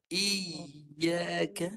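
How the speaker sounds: "Iyyaka" yy held without stopping the voice; "Iyyaka" with a smooth unbroken yy; no stop. Shown as a demonstration. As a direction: keep the voice going through "Iyyaka". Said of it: "jangan stop suara".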